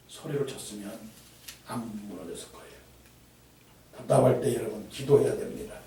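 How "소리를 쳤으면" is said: usual speaking voice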